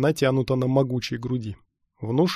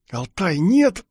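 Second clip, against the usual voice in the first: raised, urgent warning; urgent hiss; 7 semitones higher, 6 dB louder, much slower than usual. A narrator's reading. Companion behind tries to stop his friend, hissing urgently. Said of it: "прошипел за спиной Дис".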